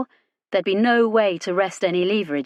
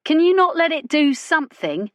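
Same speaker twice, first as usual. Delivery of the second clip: urgent plea; 9 semitones higher, 4 dB louder than usual. A narrator's reading. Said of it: "Jane asked".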